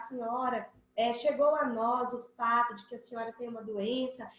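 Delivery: soft, careful, respectful tone; respectful and formal. Shown as a demonstration. Interviewer addresses a sick woman gently, soft and formal. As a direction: break the news gently and properly. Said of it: "eu tenho que chegar".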